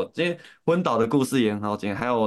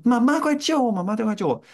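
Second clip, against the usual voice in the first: mock girl's cry, much higher and louder; pleading girl; 12 semitones higher, 3 dB louder than usual. Scammer plays a daughter begging mom for help. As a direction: squeal like a kidnapped daughter begging. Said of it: "然后就说".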